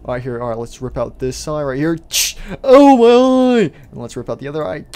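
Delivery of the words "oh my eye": loud, high, drawn-out wail; pained scream. Acted. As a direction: howl in hammed-up agony. Freddy's eye ripped out.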